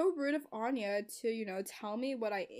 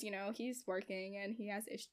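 normal talking voice

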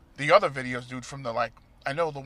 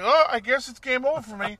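mock resigned; 9 semitones higher, 8 dB louder than usual. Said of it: "be like".

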